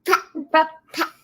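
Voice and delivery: high singsong play words. Real, not acted. Playing with the baby.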